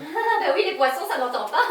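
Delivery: amused, laughing through the line. Acted. Secretary joking back to the doctor, laughing. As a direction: let giggles break into the words.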